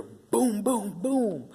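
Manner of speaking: loud, high booming bangs; imitated banging. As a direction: boom out the pounding on a door. Imitates banging on lobby doors.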